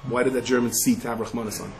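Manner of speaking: normal talking voice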